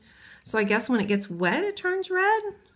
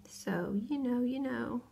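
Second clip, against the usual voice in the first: whispered aside; 2 semitones higher, 8 dB quieter, slower than usual. Real, not acted.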